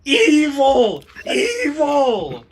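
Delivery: high, drawn-out cry of alarm; yelling orc cleric. Acted. Game master voices an orc cleric yelling at Justin, high accusing yell. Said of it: "The orc cleric is yelling".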